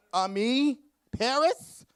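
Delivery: high, loud, posh name-drop; high fashion exclamation. Acted. Talker bragging about designer clothes, in a loud high mock-posh voice. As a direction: belt out the brand names like a diva.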